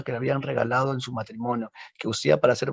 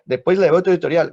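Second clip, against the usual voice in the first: normal talking voice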